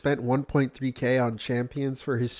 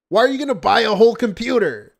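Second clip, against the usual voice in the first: exasperated yell; 6 semitones higher, 6 dB louder than usual. Real, not acted. Exasperated rant at streamer.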